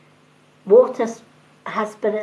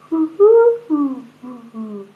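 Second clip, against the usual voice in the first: pigeon cooing imitation; 4 semitones higher, 5 dB louder than usual. Shown as a demonstration. To her audience, showing a pigeon's call: high hooting coo. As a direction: coo like a pigeon, first hoo high and loud. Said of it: "a pigeon's call will go".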